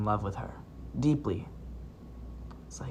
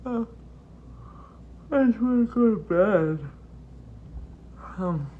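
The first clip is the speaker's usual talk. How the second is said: high yawn through the words; 9 semitones higher than usual. Real, not acted. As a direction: say it mid-yawn. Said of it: "after watching me yawn".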